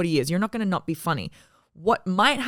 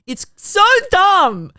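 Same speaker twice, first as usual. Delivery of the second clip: much higher, loud, slow giddy cry; giddy disbelief; 14 semitones higher, 9 dB louder, much slower than usual. Real, not acted. Telling her friend of the viral clip, giddy loud disbelief. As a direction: stretch it out, delighted. Laughing at own vision story.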